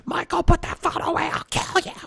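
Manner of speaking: very high shriek that drops to a breathless whisper threat; furious kid sister, shrill then whispered; tantrum scream. Retold or imitated.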